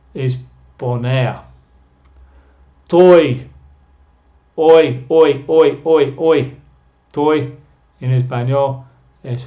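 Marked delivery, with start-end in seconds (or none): 2.9-6.6 toy, oi, oi said loud, slowly fading; teacher drilling the oi sound; vowel drill. Shown as a demonstration. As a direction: bang out the oi of toy again and again. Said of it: "El sonido es oi".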